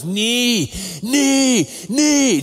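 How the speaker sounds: put-on high, loud wailing knee knee like a hurt toddler; mock a two-year-old's crying wail; father imitating his toddler's tearful cry. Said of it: "just screaming, crying".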